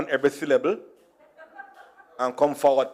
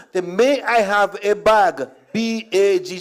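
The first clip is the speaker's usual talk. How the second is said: slow and deliberate, word by word; slow, careful repeating; 3 semitones higher, slower than usual. Retold or imitated. Customer spelling it for a baffled shop lady, slow and careful. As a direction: spell it out slowly for someone not understanding.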